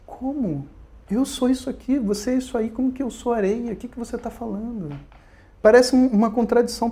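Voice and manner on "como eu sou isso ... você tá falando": higher, softer puzzled questioning; acted bafflement. Dreamer told that he is the sand, acting baffled. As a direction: play someone mildly thrown by a strange claim.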